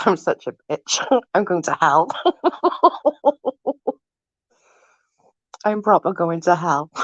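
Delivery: usual speaking voice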